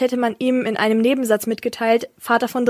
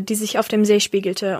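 usual speaking voice

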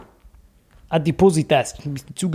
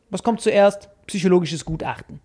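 normal talking voice

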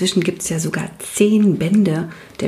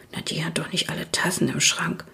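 whispered, much softer mumble under the breath; mumbled; 2 semitones lower, 7 dB quieter than usual. A narrator's reading. Girl mumbles about the teacher behind Ida, soft mumble. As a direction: mutter it so the teacher won't hear. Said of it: "nuschelte sie".

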